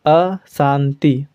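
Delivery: अशांति drawn out very slowly with a clear a; अशांति spelled out slowly the Hindi way; slow Hindi demo. Shown as a demonstration. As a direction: stretch every sound of अशांति, a-shanti. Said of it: "और हिंदी में".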